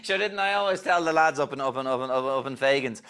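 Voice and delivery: lightly voiced Dublin pub politician; a hint of a chummy old party man; hypnotised impression. Hypnotised guest becomes a chummy politician, lightly voiced. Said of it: "the person you are thinking of".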